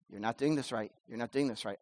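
a quiet nudge from within; inner voice. Retold or imitated. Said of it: "the Spirit is going".

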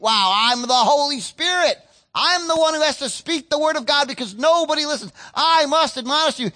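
smug Holy Spirit impression; mock self-righteous preacher. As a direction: lord it over them. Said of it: "And you think".

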